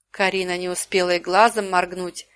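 ordinary delivery

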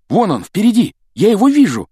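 high, loud cry of joy; overjoyed, high-pitched exclamation; delighted. A narrator's reading. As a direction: shout out a thrilled sighting. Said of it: "обрадовался Гарри".

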